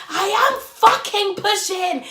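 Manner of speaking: high, loud, slow furious shout; angry snap. Retold or imitated. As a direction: spit each word out at him. Snapping at partner in labour.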